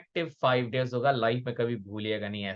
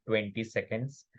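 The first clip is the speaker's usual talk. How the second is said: ordinary delivery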